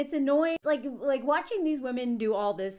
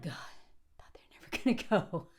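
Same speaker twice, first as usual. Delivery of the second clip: whispered, lower relief; relieved whisper; 5 semitones lower than usual. Retold or imitated. Woman after the drunk men finally leave, whispered relief. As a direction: breathe it out in a whisper.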